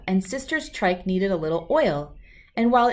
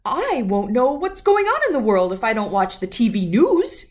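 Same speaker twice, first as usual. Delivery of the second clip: louder, higher indignant protest; protesting Papa; 3 semitones higher, 6 dB louder than usual. Acted. Papa protesting to Mama about missing the news, louder. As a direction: object like you've been robbed. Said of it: "protested Papa".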